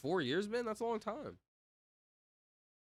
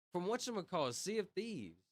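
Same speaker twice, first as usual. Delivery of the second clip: ordinary talk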